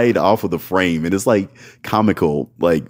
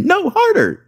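mock high squeal. Partner's demand quoted.

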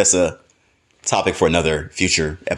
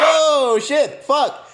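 much higher alarmed yelp; panicked curse; much slower than usual. Retold or imitated. Freaking out in his head at the naked husbands, high panicked cursing. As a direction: blurt it in a rising panic. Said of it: "I was like".

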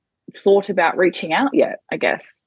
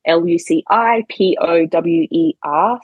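usual speaking voice

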